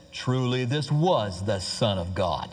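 low, slow movie-cowboy drawl; deadpan film-star impression, drawn right out; John Wayne. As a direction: say it slowly, deep and stiff. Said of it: "And John Wayne said".

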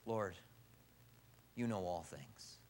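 low, slow, hushed surrender; humbled. Acted. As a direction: answer softly and humbly, with a pause. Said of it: "Instead of being that arrogant".

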